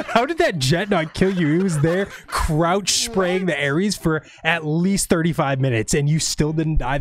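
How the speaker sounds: ordinary delivery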